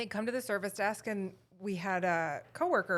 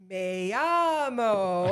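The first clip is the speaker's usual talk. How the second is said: very slow, louder and drawn out; mock classroom drill, stretched slowly; slow teacher Spanish; 7 dB louder, much slower than usual. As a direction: overarticulate like a patient Spanish teacher. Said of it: "very, like".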